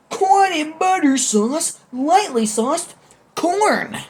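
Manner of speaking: high, loud showman food announcement; grand announcer. Pretend. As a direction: present the corn like a game-show prize.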